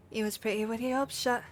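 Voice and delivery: put-on lightly done rough British pirate accent; a sneering pirate voice in a shaky British accent; amateur British accent reading a pirate villain; attempted British accent. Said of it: "I apologize in advance for the accent".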